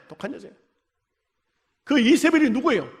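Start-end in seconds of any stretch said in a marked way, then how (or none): 0.1-0.6 quiet repeat. Shown as a demonstration.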